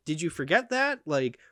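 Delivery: normal talking voice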